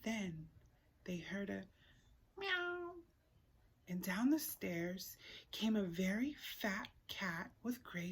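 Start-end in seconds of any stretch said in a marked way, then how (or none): 2.4-2.9 high imitated cat meow. Reader imitating the cat meowing on the stairs, high meow. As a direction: mew like a small cat.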